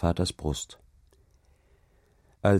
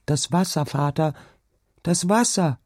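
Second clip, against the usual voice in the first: put-on high, scared little girl calling out; frightened cry; 9 semitones higher, 3 dB louder than usual. A narrator's reading. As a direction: cry out in alarm to father. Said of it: "rief sie". (erschrockener Kinderschrei)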